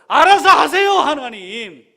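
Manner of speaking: high, loud, whining tirade; hammed-up despairing outburst; exasperated protest to God. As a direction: complain bitterly as an exhausted soldier. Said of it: "뭐 이런 마음인 거죠".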